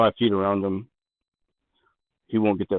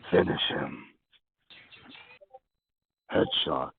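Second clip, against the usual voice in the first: low, hushed inner voice; voice in the head; 3 semitones lower, 10 dB quieter, slower than usual. Acted. Game master as the voice in the player's head, low and hushed. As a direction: speak low and soft, like a prompting thought. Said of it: "You hear this voice in your head that says".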